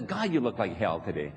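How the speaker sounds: low, fast, laughing jab; a half-laughing put-down; mock blunt insult. Mock unkind acquaintance insulting you.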